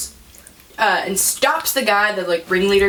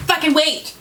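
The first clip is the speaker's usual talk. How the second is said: exasperated; 7 semitones higher than usual. Retold or imitated. Snapping at impatient guys in retelling.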